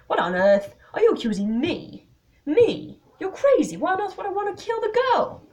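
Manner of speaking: loud, high indignant outburst; outraged. Acted. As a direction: build from disbelief to shouted denial. Desmond outraged at accusation.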